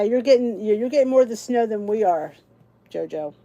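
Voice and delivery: normal talking voice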